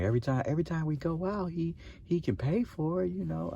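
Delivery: high, mocking whine of a jealous friend. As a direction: squeak it out like a complaining buddy.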